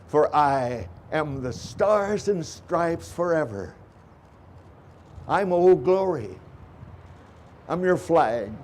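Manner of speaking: measured, solemn patriotic recitation; formal recitation. Acted. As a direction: recite it like a ceremony address. Recites patriotic flag poem.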